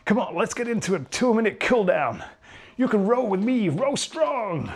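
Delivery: cheesy alpha-male trainer hype; hyped fitness coach. Acted. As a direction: sell it like an overexcited gym coach. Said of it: "How irritating would that be if I suddenly became that?".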